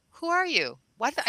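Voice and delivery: mock astonished stranger; stunned disbelief. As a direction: play a man caught off guard. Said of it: "he's just going like".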